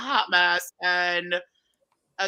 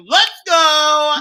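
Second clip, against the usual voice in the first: loud, high imagined battle cry; hammed-up excited holler; pumped-up cheer; 7 semitones higher, 9 dB louder than usual. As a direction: yell it as if charging at the feast. Imagined cheer at seeing the pie.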